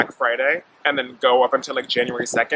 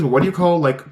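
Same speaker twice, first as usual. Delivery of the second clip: ordinary talk, nothing marked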